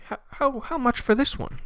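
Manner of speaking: a high little voice asking a price; squeaky buyer voice. Retold or imitated. Art buyer asks the painter a price, high squeaky voice. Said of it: "someone's like".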